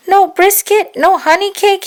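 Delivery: put-on very high, loud child's protest; disappointed little boy. A narrator's reading. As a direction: cry out like a let-down kid. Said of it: "Harry asked".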